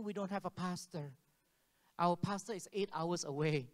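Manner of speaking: normal talking voice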